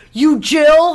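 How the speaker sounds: mock yelled question; retold abrasive yell. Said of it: "like, yelling abrasive".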